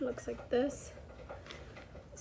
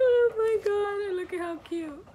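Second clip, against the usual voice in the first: gushing; 10 semitones higher, 6 dB louder than usual. Real, not acted. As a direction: gush over how cute it is. Overcome by cuteness.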